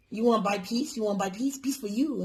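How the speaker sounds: the Chinese shop lady pushing a sale; light mock Chinese accent, selling; imitated Chinese accent. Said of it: "talking in fucking broken English".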